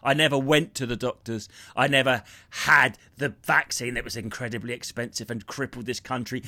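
ordinary talk

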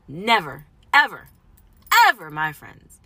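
loud, punched single words; emphatic anger. Real, not acted. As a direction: hit each word harder, pitch leaping up. Venting anger at false friends.

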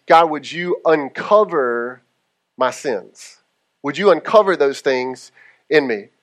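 slow, hushed praying voice; quiet prayer. Acted. Pastor voicing David's prayer, slow and hushed. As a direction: ask God gently, drawn out.

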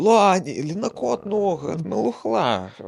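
put-on a Russian cleaner pleading in a Russian accent; Russian accent in Hebrew. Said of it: "כמו רומן".

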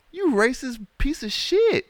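high, drawn-out mock outrage; retold indignation. Storyteller replaying the reaction to a rude customer, mock indignant.

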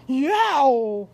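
loud, high yowl of pain; pained yelp. Acted. Dog just bitten on the neck, yelping loudly.

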